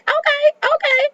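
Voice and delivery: very high falsetto reaction; squeaky approval. Acted. Host reacting to Tracy's good sense, squeaky high. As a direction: chirp it in a tiny squeak.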